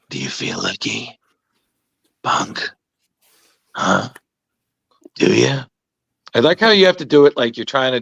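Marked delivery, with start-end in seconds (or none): 0.1-5.6 Clint Eastwood. Retold or imitated. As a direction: menace him softly, gunslinger style. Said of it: "our best Clint Eastwood impressions".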